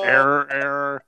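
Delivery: monotone robot error alert. Acted. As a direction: repeat it evenly like a machine. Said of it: "what has Robbie the Robot sound?".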